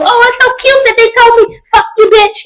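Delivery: high, sugary mock parent gushing; mocking doting parent. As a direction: ham up a parent who finds it cute. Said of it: "They do".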